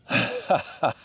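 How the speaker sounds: high, happy chuckling; delighted laugh. Real, not acted. Angler loving his catch, high delighted laugh. Delighted after landing the fish.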